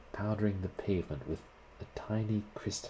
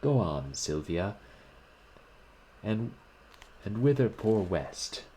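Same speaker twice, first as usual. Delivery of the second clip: gaily; 3 semitones higher, 5 dB louder than usual. A narrator's reading. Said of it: "he spoke again gaily".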